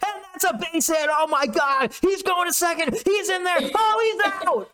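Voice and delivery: a frenzied announcer shouting each play; excited Latin announcer. Retold or imitated. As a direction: scream the call like a frenzied foreign announcer. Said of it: "Whereas in Mexico or Japan, they're like".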